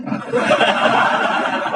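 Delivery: long high cackling laugh; high unvoiced giggling fit; high laughter. Real, not acted. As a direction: burst out laughing at the joke. Preacher laughs at his story.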